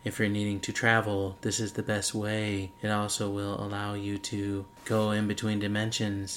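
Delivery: deep, soft, echoing announcer voice; echoing voice inside helmet. Acted. Sign-off continued in deep echo voice.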